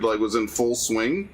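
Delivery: ordinary delivery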